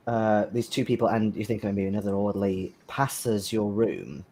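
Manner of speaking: ordinary talk, nothing marked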